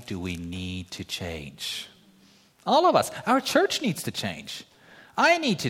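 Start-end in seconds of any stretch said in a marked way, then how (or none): 0.1-1.9 soft and slow. Real, not acted. Dropped confession.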